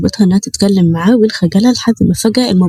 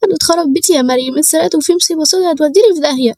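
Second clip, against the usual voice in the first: high-pitched, distressed wail of a voice; tearful panic; 8 semitones higher than usual. A narrator's reading. Robbed young woman confides in her cousin, tearful and panicked. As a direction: plead in a high, frightened whimper. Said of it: "عينيها وارمة وحمرا من كتر العياط".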